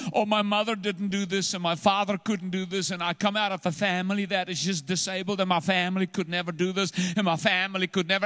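high, fast whining lament; whiny complaining voice. Retold or imitated. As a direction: complain like a defeated person. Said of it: "Can I tell you how we measure".

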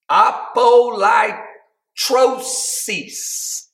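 apolytrosis drawn out slowly, loud and high; preacher's slow shout of apolytrosis; proclaimed Greek word. Acted. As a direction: shout the word, stretching every syllable. Preaching climax.

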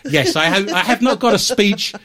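laughing. Real, not acted. Denying while laughing.

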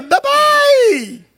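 put-on high whiny childish yelp; Kiko's cry. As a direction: squeal it like a crying kid. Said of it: "Aí ele grita".

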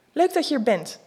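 eager hello to the stripper at the door; enthusiastic. Acted. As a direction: narrator greeting the stripper, enthusiastic. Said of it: "zeg ik enthousiast".

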